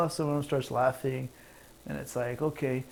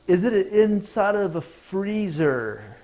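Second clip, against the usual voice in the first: slow, higher, louder mock concern; mock helpful; 6 semitones higher, 5 dB louder, slower than usual. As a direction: sell the silly question straight.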